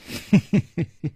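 high, tittering giggle; teasing giggle. A narrator's reading. Miss Ping giggles after luring the guest along, teasing. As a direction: giggle mischievously, in character. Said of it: "忽然笑着说".

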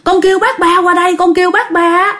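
high-pitched, alarmed retold order; acted father's urgent call, pitched high. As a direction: send the child running for help. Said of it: "Cái ba mới kêu rằng".

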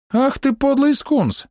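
put-on high, slow, indignant girl's cry; outraged from the grove; indignant archer girl. Outraged archer girl shouts at the hero, high and slow. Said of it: "Возмущённый ответ".

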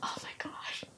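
tiny whispered gasp of emotion; very soft, tearful hush; overwhelmed whisper. Retold or imitated. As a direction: whisper it, on the edge of tears. Repeats own overwhelmed reaction.

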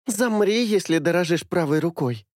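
put-on light threatening girl voice; girl's voice. A narrator's reading. Female character voice, threat. (девичий голос с угрозой)

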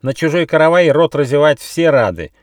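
high, loud cross parrot; chiming-in complaint. A narrator's reading. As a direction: join in the scolding loudly. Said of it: "подхватил Какаду".

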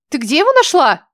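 выше и громче, резко; рявкни на подругу; рявкнула. Acted. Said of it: "рявкнула". (резко рявкнула)

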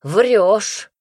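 loud, blunt grunt of disbelief; grunted retort. A narrator's reading. Young prince disbelieving what he hears, grunting. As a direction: throw it out gruffly and loudly. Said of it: "буркнул княжич".

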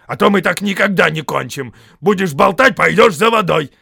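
loud, high troll threat; angry scolding. A narrator's reading. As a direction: scold a chattering mate.

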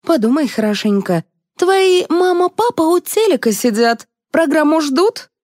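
insistent, high-pitched persuasion; pouncing. A narrator's reading. Liza working on Valentina about waiting parents, insistent. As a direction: press her hard with eager questions. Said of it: "налетела на неё Лиза".

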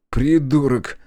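grumbled insult. A narrator's reading. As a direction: toss out a grumpy insult. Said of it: "буркнул Колян".

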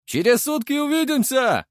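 high, loud parting shout; storming off shouting. A narrator's reading. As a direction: yell it back over your shoulder. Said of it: "выкрикнул он на прощание".

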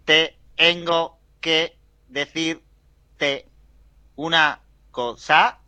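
slow, flat speech-synthesizer voice. Pretend. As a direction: speak like an old text-to-speech machine. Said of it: "concurso mundial de imitadores de festival".

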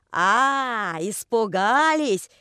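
slow, loud jeer in a put-on voice; drawn-out teasing to provoke; mocking taunt. A narrator's reading. Taunting the workers.

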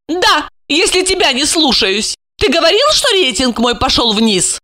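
high-pitched proud woman; proudly defiant high voice. A narrator's reading. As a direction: confirm it with pride, voice lifted. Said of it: "с гордостью подтвердила".

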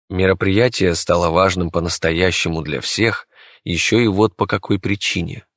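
ordinary delivery

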